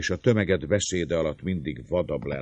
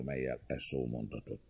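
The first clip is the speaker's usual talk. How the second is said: ordinary delivery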